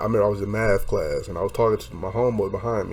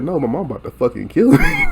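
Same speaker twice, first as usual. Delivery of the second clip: louder, higher mock-panicked protest; retold panicked shout; 6 semitones higher, 6 dB louder than usual. Telling paramedics why he cried, retold panicked shout. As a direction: reenact a yelled, half-laughing protest.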